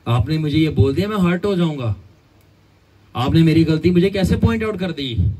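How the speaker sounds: hammed-up fragile ego begging to be spared; mock proud whiner. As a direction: whine like your pride is precious gold. Mocks a person guarding pride.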